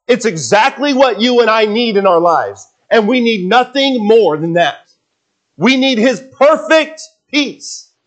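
high, forceful sermon climax; impassioned preaching. Real, not acted. Preacher tells believers what they need, impassioned high preaching. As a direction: press it home to the congregation, pitched high.

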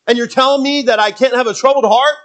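a disciple pushing back in disbelief; protesting disciple. Acted. Disciple objects to Jesus, loud and incredulous.

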